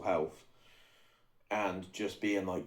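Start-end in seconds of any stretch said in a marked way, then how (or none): none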